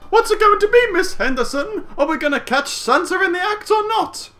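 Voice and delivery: put-on very high, cheeky kid voice; hammed-up squeaky child; teasing little boy. A narrator's reading. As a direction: goad like a pesky little brother. Brother teases sister in story.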